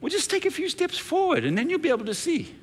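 high, light teasing voice with a laugh; high daughter voice. Retold or imitated. Daughter teasing her grumpy father, high-pitched. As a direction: tease him gently in a girl's voice. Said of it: "she goes".

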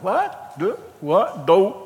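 Homer Simpson confusion. Retold or imitated. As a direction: play dumb like Homer Simpson. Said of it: "having a Homer Simpson moment".

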